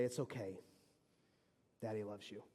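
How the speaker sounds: soft, low, hushed reassurance; a dad whispering comfort to his kid; soothing father. Retold or imitated. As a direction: comfort a crying child quietly. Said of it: "And I can say".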